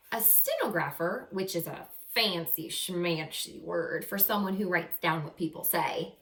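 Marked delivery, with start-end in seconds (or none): none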